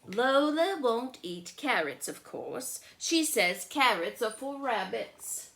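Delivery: bright storybook reading; story narration. A narrator's reading. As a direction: read it out like a picture book to a child. Reads the child narrator.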